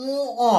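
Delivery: উ, অ as long nasal "uu", then open "o"; correct letter name. Shown as a demonstration. Teacher reminding students of the true pronunciation, drawn-out and split. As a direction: separate উ from অ with a short pause. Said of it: "প্রকৃত উচ্চারণ কি".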